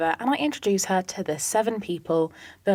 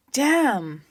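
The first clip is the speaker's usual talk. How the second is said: a light put-on Southern accent, higher; lightly voiced Southern accent, half-hearted; mock Southern accent; 6 semitones higher than usual. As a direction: tease in a lightly done Southern American accent. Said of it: "Probably not in a Southern accent".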